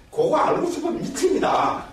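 pitched far up, urgent craving; high-pitched mock desperation; worked-up emphasis. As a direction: act out being crazy to know. Dramatising a mind desperate to know.

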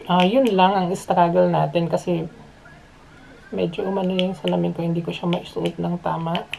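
muffled behind respirator; speaking inside half mask; through a face mask. Real, not acted. Seen 3: Respirator worn over nose and mouth throughout labelled words.

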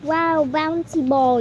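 normal talking voice

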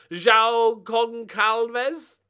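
"João Goncalves" read slowly and loudly, each syllable dragged out and wrongly sounded; mispronounced name. Shown as a demonstration. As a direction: spell out "João Goncalves" slowly like an unsure reader. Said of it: "That's not how it's pronounced".

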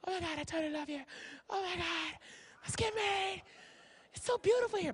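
soft, high, lovey-dovey voice; movie honeymooner. Acted. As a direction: gush softly like a smitten honeymooner. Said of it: "this is the whole movie right here, the whole preview".

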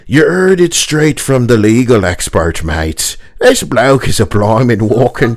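wobbly mock Irish brogue; Cockney slipping into cartoon Irish; leprechaun-like Irish accent. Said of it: "Fucking leprechaun now".

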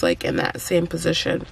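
ordinary talk

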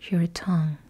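ordinary talk, nothing marked

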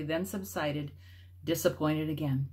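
plain reading voice

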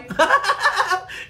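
high burst of laughter; laughing. Real, not acted. Vlogger amused by crazy Facebook talkers, high laugh. Laughs at her own joke.

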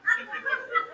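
soft high giggle; high airy laugh. Real, not acted. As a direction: let out a breathy chuckle. Laughing at own joke. (risita aguda)

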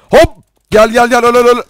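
high, loud repeated cries; frantic battle shout. Real, not acted. Player luring an enemy in to kill, frantic shouting. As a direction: shout each word like a blow as you attack. Urging enemy to come, die.

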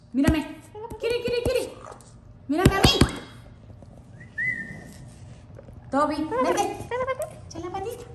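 high cooing to a parrot. Real, not acted. Owner coaxing her parrot in high baby talk. Calling the pets.

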